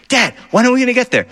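put-on fast, high kid whine; impatient kid. Child asking dad in the car, fast whiny voice. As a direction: whine like a bored kid in the back seat.

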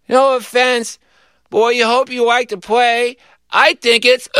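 put-on high small-child voice; queasy little boy. A narrator's reading. Sick little boy finishing his speech, high child voice. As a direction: press on like a sick little kid.

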